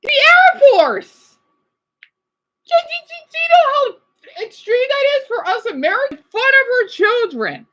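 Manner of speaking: high, loud outraged shout; heated shouting. Real, not acted. Streamer shouts at the killer officer, outraged and heated. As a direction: explode at the news, outraged. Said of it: "I got a little heated".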